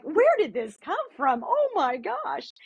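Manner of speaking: high, slow, stunned gasp of wonder; amazed surprise. Retold or imitated. Mother in the car hearing the song, amazed. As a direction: caught off guard, voice climbing way up.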